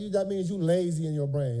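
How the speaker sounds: ordinary talk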